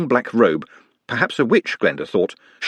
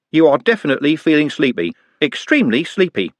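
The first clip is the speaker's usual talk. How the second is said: higher, slightly louder hypnotist patter; mock hypnotist; 6 semitones higher, 3 dB louder than usual. A narrator's reading. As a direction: play at hypnotising, a bit grand.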